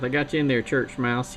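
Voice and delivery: ordinary delivery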